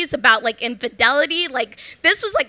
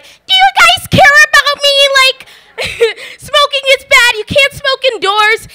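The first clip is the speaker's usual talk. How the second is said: put-on much higher and louder, squeaky child's plea; shrill pleading; 12 semitones higher, 7 dB louder than usual. Ten-year-old begging the council, squeaky and much louder. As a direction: overdo it like a frantic kid begging. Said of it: "Well, it was probably like".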